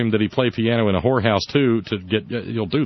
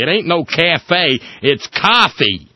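mock patriot; 5 semitones higher, 5 dB louder, slower than usual. Mocking the politician, loud and slow. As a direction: hammer it home like an angry patriot. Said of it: "Steve Lonegan's afraid".

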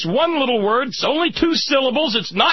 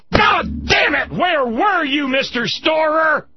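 furious cry; 2 semitones higher than usual. Real, not acted. Host cursing at the veteran over the wars, furious. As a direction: curse it out in anguish. Angry rant peak.

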